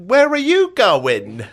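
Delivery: very high, loud, drawn-out screech; mock scolding woman. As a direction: screech it slowly like an outraged stranger. Repeats angry woman's yell.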